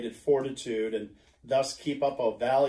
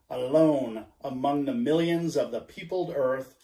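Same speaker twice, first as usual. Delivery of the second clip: plain reading voice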